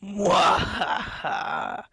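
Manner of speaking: slow hammy evil cackle; evil villain laugh. Acted. Villain triumphs over prisoners.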